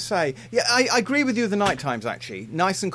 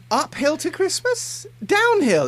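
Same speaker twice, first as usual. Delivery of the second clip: "uphill to Christmas?" high and slow, then a loud high "Downhill"; pulling him up on a slip; mock-incredulous correction; 13 semitones higher, 4 dB louder, slower than usual. Real, not acted. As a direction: correct him with amused disbelief. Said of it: "excuse me".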